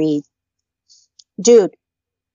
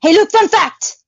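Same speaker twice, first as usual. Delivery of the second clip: high, loud fun-fact pitch; excited announcer; 10 semitones higher, 3 dB louder than usual. Real, not acted. Telling Luke a fun fact, high and loud. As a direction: sell it like a TV host.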